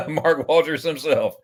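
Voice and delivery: slow, drawn-out name with a falling finish; grand announcer intro. Pretend. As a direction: roll out the name like a big entrance. Grandly introducing a chat guest.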